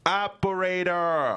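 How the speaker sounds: frustrated repeat. Acted. Son yelling at phone robot.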